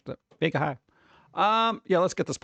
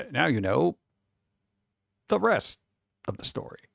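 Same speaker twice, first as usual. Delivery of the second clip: Paul Harvey sign-off. Retold or imitated. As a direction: draw out the pause like an old radio host. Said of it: "then he used to have these long pauses".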